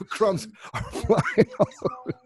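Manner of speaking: high, helpless laughing through the words; real laughter. Real, not acted. Storyteller describes the flying cracker crumbs, really laughing.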